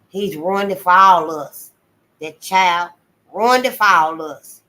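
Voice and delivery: loud, slow, weighty reproach; disgusted scolding. Acted. Mary Webb condemning the boy, loud and slow. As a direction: scold him with heavy disgust.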